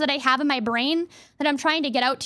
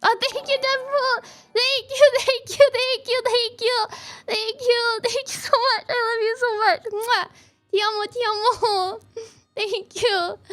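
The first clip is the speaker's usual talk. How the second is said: high, overwhelmed gratitude; gushing thanks; 4 semitones higher, slower than usual. Real, not acted. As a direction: squeal out grateful thanks with little laughs.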